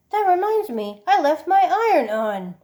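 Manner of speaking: high, loud startled realisation; sudden alarm. Pretend. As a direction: blurt it out in a panic. Joke about leaving iron on.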